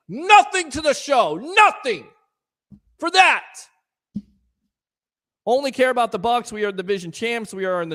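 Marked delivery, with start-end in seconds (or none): 0.1-3.4 louder and higher with each nothing; rising scold. Real, not acted. Host scolding a chat troll for giving nothing. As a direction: build to an exasperated shout.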